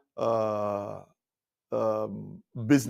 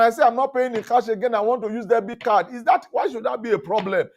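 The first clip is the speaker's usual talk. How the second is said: higher, louder indignant protest; exasperated argument; 9 semitones higher, 4 dB louder than usual. Retold or imitated. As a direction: argue your case, fed up. Arguing with supervisor.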